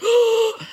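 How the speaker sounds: sharp high mock gasp; a sharp high gasp of mock shock. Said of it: "people would be like".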